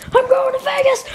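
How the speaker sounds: high shrieking cheer; ecstatic high yell. Acted. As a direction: yell it out overjoyed. Repeated joyful yell.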